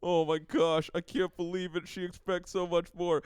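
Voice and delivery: high, loud mock panic; panicked sitcom boyfriend, hammed up; freaking out. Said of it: "freaking out 'cause he's like".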